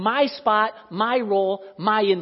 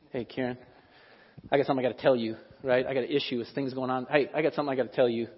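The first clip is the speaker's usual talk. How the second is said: lower, softer gossiping; 7 semitones lower, 6 dB quieter, faster than usual. Retold or imitated. Gossip pulls Karen aside to complain, low and hushed. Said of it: "If I'm going".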